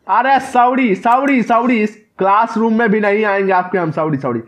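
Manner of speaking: high, quick pleading sorries; panicked apology. Real, not acted. Player caught by the nun in the classroom, high pleading apology. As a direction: back out of her classroom begging.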